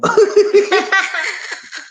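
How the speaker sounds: high, loud laugh out of nowhere; a big high cackle; burst of laughter. Real, not acted. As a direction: laugh hard at the thought of it. Laughing at the penguin idea.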